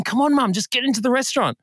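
acted-out impatient son; exasperated urging. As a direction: push a stubborn mum along. Acting out coaxing mum inside.

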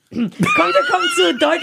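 drawn-out, high announcer voice; hammed-up ad read; mock announcer. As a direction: stretch it out like a radio jingle. Ad-style plug for her book.

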